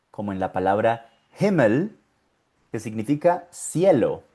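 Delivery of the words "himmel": "Himmel" with audible H and short i; sounded H. Shown as a demonstration. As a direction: model "Himmel" in German, breathed H first. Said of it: "La H con la I".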